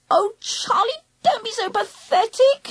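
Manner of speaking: high, wheedling plea; begging. A narrator's reading. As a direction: beg and scold in one high whine. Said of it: "she begged".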